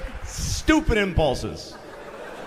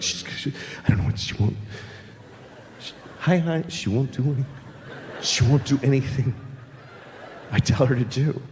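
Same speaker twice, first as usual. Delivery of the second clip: nervous half-whispered worry; scared whisper; 5 semitones lower than usual. Acted. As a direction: whisper it like a frightened dad. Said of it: "They're like".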